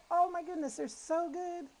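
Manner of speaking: high, delighted rave; excited. Real, not acted. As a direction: gush over the taste. Raving about the crispy crackers.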